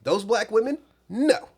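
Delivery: lightly hammed-up disdainful refusal; mocking imitation of co-host. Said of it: "is you being like".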